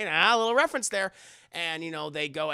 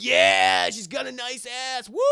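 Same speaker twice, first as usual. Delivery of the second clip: loud hollered party whoop; mock cheering; 5 semitones higher, 7 dB louder than usual. Partygoers watching the stream, rowdy cheering.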